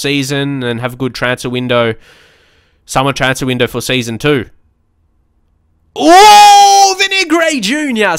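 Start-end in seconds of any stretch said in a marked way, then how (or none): 6.0-8.2 high, drawn-out celebratory shout; loud, soaring cheer; excited goal shout. Real, not acted. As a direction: cry out as the goal goes in. Reacting to a goal scored.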